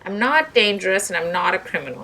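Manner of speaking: firm, loud denial; insisting. Acted. Doctor Peck insisting on her innocence, firm and loud. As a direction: stand your ground. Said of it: "insisted Doctor Peck".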